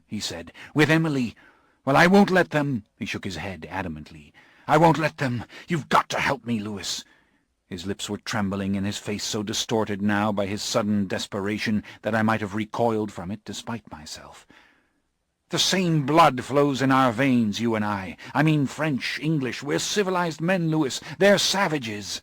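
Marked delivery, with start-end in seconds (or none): 0.8-2.8 higher, louder desperate Morgan; a frightened man insisting; agitated Morgan. Acted. Morgan refuses. 4.7-22.2 higher, louder desperate Morgan; a frightened man insisting; agitated Morgan. Acted. Morgan pleads with Lewis.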